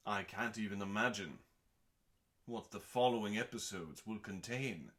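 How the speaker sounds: ordinary talk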